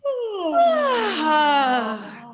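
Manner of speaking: a long loud voiced sigh, pitch sliding down; big open sigh. Shown as a demonstration. Facilitator leading a group stretch, loud released sigh. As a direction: let the whole body sigh out on ah. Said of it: "just a stretch and a sigh".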